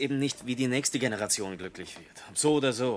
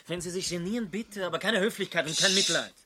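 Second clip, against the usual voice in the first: indignant outburst; 8 semitones higher, 6 dB louder than usual. Acted. Proud outburst against his pity.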